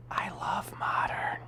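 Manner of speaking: soft whisper after shouting; hushed. Real, not acted. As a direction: whisper it, catching yourself. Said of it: "Sorry, I'm being loud".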